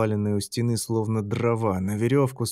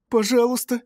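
high, straining moan of pleading; moaning plea; 13 semitones higher, 4 dB louder than usual. A narrator's reading. Lyolya begs Martyn while holding up her husband, high moaning. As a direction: beg through the effort of holding him. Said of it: "простонала она".

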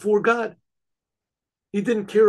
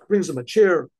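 plain reading voice

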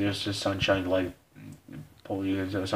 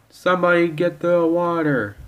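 loud, high shout; raised-voice alarm; urgent call for help; 8 semitones higher, 6 dB louder than usual. Acted. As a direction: call out in an emergency. Acted emergency.

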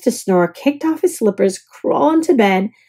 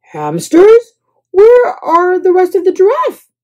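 high, loud astonishment; baffled exclamation; bewildered; 8 semitones higher, 6 dB louder than usual. A narrator's reading. Guest to bellhop, astonished.

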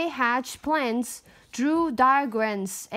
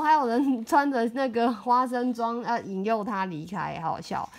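usual speaking voice

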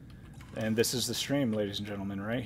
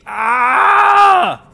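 startled scream; 14 dB louder than usual. Real, not acted. Startled by a Leviathan.

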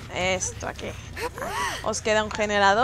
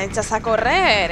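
usual speaking voice

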